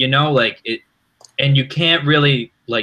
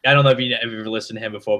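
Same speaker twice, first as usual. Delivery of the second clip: ordinary talk, nothing marked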